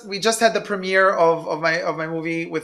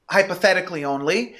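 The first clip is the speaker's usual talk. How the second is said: ordinary talk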